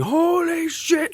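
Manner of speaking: high, very drawn-out awestruck cry; first-time viewer's long, high-pitched exclamation; amazed reaction. Acted. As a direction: stretch it out in mock astonishment.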